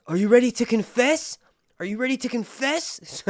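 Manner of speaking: high-pitched mock demand; badgering septa. Septa Unella nagging jailed Cersei, high badgering. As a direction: sing out the demand, loud then easing.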